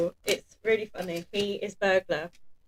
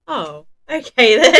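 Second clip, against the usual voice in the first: laughing; 9 semitones higher, 14 dB louder than usual. Retold or imitated. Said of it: "And I'm like".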